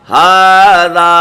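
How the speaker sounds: ہاذا with the first a held very long; drawn-out recitation of ہاذا; long madd. Shown as a demonstration. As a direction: stretch the ha in ہاذا slowly. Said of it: "تو یہ پڑھا جائے گا".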